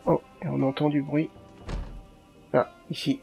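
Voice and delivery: ordinary delivery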